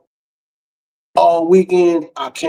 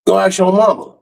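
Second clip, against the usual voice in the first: higher mock dad brush-off; dismissive dad; 6 semitones higher than usual. Father waving off son, pitched up and dismissive. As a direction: fling it away, pitched up.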